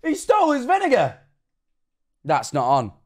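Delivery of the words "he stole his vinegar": high-pitched incredulous outcry; outraged disbelief. Real, not acted. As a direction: exclaim it way up high, scandalised. Reacting in outrage to clip.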